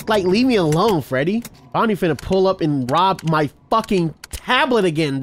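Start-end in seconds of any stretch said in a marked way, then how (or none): none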